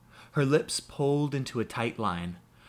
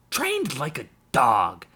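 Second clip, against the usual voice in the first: exploding; 3 semitones higher, 5 dB louder, slower than usual. A narrator's reading. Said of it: "Jem exploded into movement".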